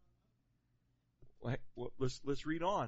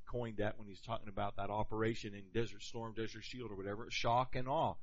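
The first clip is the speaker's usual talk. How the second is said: ordinary talk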